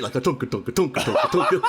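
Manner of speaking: chugging mouthed drum-machine beat; imitated backbeat. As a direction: mimic a cheap karaoke backing rhythm. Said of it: "there's, like, a backbeat".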